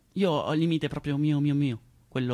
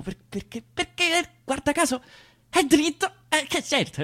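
ironic high emphasis; 12 semitones higher, 4 dB louder than usual. Acted. Mock revelation of the obvious.

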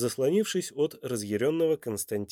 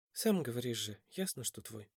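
quiet aside; 12 dB quieter than usual. A narrator's reading. Mother confirms the boy is his, very quiet. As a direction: murmur it under the breath. Woman answers him privately.

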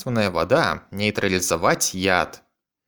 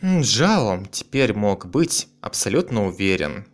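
ordinary delivery

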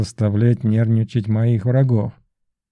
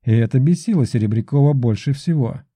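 usual speaking voice

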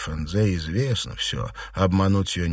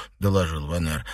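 ordinary delivery, nothing marked

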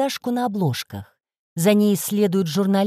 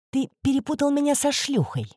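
sweet smile; 7 semitones higher than usual. A narrator's reading. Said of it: "мило улыбнувшись".

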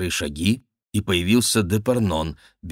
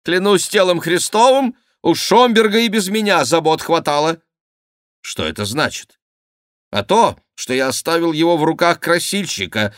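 indignant courtier; 8 semitones higher, 4 dB louder than usual. Acted. Favourite snaps back at the king.

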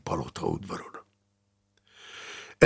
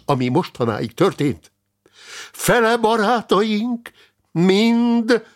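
enthusiastic; 11 semitones higher, 4 dB louder than usual. Acted. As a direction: proclaim it with fervour, stretching key words. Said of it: "folytatta Richardus barát lelkesen".

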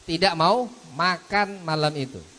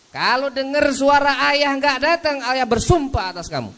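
stern father voice; 12 semitones higher, 6 dB louder than usual. Retold or imitated. Father's oath, retold.

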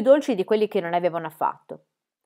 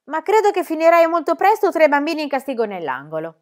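stern teacher; 8 semitones higher, 8 dB louder than usual. Acted. Stern teacher threatens corner.

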